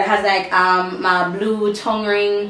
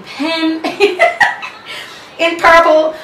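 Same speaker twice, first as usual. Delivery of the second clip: laughing; 7 semitones higher, 5 dB louder, slower than usual. Real, not acted. Laughing at pens.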